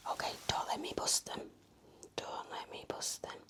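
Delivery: ordinary talk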